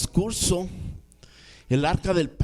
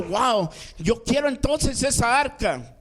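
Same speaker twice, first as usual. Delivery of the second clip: higher-pitched, mildly excited retelling; asombro entusiasmado; 7 semitones higher than usual. Retold or imitated. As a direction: act out David's eager wow. David reacciona a la bendición. (asombro con entusiasmo)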